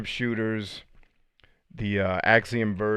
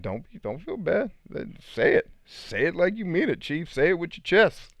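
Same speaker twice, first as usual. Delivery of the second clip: high-pitched, hammed-up coaxing; playful egging-on; 9 semitones higher than usual. Husband urging his passive wife to get hardcore, high playful egging-on. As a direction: push the voice way up, mock pep talk.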